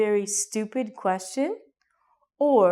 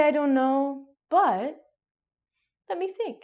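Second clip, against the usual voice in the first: thoughtful hesitation; 5 semitones higher than usual. Shown as a demonstration. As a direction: sound like you're buying time to think. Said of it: "you can say".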